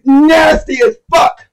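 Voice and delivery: thrilled by the punchline; hyped praise. Real, not acted. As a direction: relish every word.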